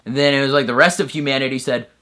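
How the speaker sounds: ordinary talk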